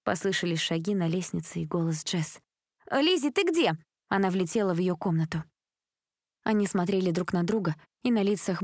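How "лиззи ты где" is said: high call across the house. A narrator's reading. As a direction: call her from the stairs. Calling up the stairs. (зовёт сестру)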